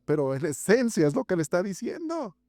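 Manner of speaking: ordinary talk, nothing marked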